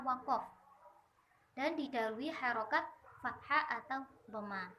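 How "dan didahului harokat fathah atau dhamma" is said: normal talking voice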